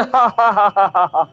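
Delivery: deliberate "ha-ha-ha" laugh; put-on belly laugh, for demonstration; demonstrated guffaw. Shown as a demonstration. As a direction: fake a long open guffaw to show what it is. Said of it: "قہقہا یعنی زور زور سے".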